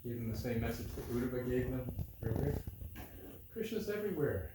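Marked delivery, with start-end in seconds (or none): 3.5-4.5 high, falling quote; mock pronouncement. Repeating Krishna's message to the gopis, mock pronouncement. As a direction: lightly send up the lofty message. Mimics the consoling message, then laughs.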